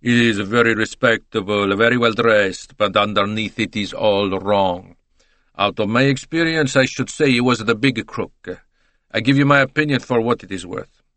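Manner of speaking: put-on emphatic Italian accent with added vowels; opinionated Italian. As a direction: voluble Italian witness pronouncing judgement. Voices Italian giving opinion.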